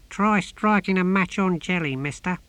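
high-pitched little creature voice, jeering; heckling tortoise. A narrator's reading. Tortoise heckling the holy men from his box, jeering high. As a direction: pipe up high like a cheeky tortoise.

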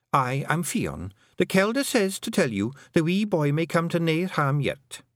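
high Scots dialect voice; Fionn. A narrator's reading. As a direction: announce it high, as a small Scots messenger. Fionn introduces herself in dialect.

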